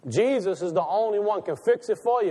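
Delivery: normal talking voice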